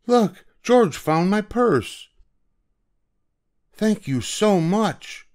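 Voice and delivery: high, slow, thankful voice; relieved lady thanking the monkey; grateful aunt. Acted. Aunt Ruby thanks George.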